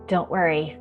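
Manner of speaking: usual speaking voice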